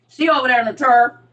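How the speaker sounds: loud "chair" with an UR vowel, like "churr"; Saint Louis pronunciation. Shown as a demonstration. Imitating Saint Louis talk, "chair" as "churr". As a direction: say "chair" to rhyme with "her". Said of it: "Like, Saint Louis is her".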